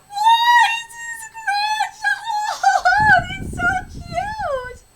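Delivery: very high, loud gushing shriek; overjoyed squeal. Acted. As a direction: shriek and laugh over an adorable baby. Gushing over the grandchild.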